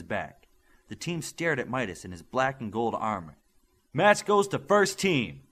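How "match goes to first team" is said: loud, slow, high call across the field; announcing the match winner to everyone, yelled. A narrator's reading. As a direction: bellow the result out slowly. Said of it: "Spurs yelled".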